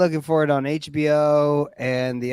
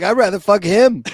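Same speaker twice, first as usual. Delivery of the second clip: louder mock Roman voice; mock Roman quip; 5 semitones higher, 7 dB louder than usual. Roman man louder, blurting out his preference.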